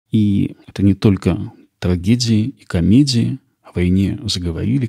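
ordinary talk, nothing marked

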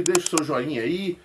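ordinary talk

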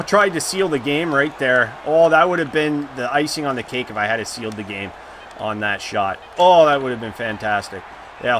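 normal talking voice

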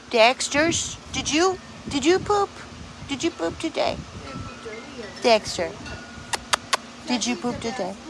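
high sing-song puppy talk. Real, not acted.

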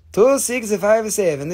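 auctioneer's slow call; claim call. Shown as a demonstration. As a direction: announce the lot number slowly.

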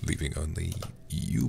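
low, hammed-up movie-trailer narration; dramatic trailer read. Dramatic reading of game intro.

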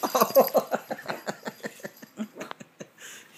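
airy unvoiced laugh; breathy laugh. Real, not acted. Dad laughs as Oliver pounces, airy laugh.

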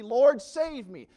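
loud, high, slow cry for help; desperate cry. Acted. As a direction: cry out to be saved. Prayer cried out.

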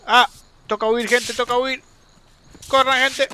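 high, loud panicked urging to run; panicked flight. Real, not acted. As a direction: yell at them to flee in a panic. Fleeing from a big fight. (huida con pánico, voz alta)

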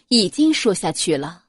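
ordinary delivery

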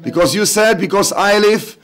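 earnest spoken prayer to the Lord. Acted. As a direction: pray aloud with devotion over the congregation. Leads congregation in prayer. (fervent congregational prayer)

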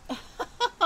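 sudden high loud laugh; burst of laughter. Real, not acted. Reacting to Gio's odd story words, loud laugh. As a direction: explode into laughter. Friend hears silly word choices.

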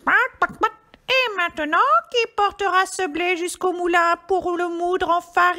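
high, loud squawky hen; little hen voice. A narrator's reading. Red hen asking who will go to the mill, high hen voice. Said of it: "la petite poule rousse demanda".

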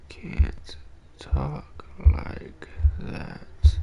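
whispered and drawn out very slowly; slow scolding whisper to chat. Real, not acted. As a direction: hush each word, stretching it.

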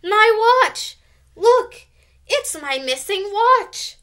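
high, loud excited cry. A narrator's reading. Karen finding her lost watch, crying out excitedly. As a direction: exclaim it in delighted surprise. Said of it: "cried Karen".

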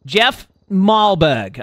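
very slow, drawn-out name; deliberate name. Pretend. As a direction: say the name slowly, word by word. Repeats name after spelling it.

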